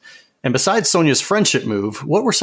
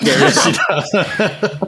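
laughing; 8 semitones higher, much slower than usual. Real, not acted. Laughing reply about Sonya.

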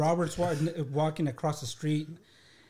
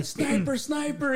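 high panicked yell of sniper; mock alarm yell; 11 semitones higher, slower than usual. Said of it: "I started yelling".

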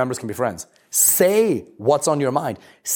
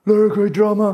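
higher, louder mocking dismissive voice; red pill guy; 8 semitones higher, 3 dB louder, slower than usual. Mocking red pill men dismissing women, higher louder sneer. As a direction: caricature a callous red pill man. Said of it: "this red pill stuff".

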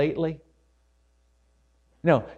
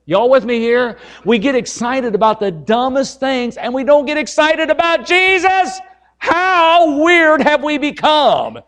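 loud, high fervent preaching; fiery revival preaching; preaching at full force; 10 semitones higher, 7 dB louder than usual. Acted. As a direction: lift the room, rising to a cry on Jesus.

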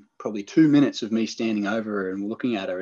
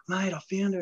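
much higher, thrilled call; imitating a mate calling out his find; excited mate; 10 semitones higher, 5 dB quieter than usual. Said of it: "He's like".

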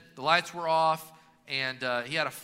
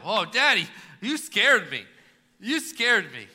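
put-on relieved child; 3 semitones higher, 8 dB louder than usual. Son relieved after the fright.

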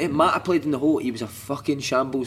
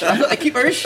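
"I thought the keepers" said high and loud, cracking up mid-line; laughing repeat; 10 semitones higher, 4 dB louder than usual. Retold or imitated. Player laughing while repeating the chairman's phrase. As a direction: giggle your way through "I thought the keepers".

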